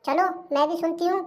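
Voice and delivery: usual speaking voice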